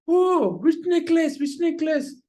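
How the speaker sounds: put-on high-pitched, urgent children's questions; eager questioning. Children ask mother about the necklace, acted urgency. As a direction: play children pressing her for details.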